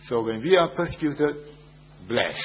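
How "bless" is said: ordinary talk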